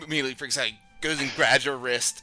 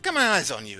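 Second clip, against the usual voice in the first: suspicious warning; 4 semitones higher, 9 dB louder, faster than usual. Acted. Crick repeats his warning.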